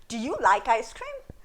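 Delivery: rising question tune ending on CREAM; yes-no question with a rise at "cream"; questioning inflection. Shown as a demonstration. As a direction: ask it, lifting the voice on "cream". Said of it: "We'll, we'll use our voice".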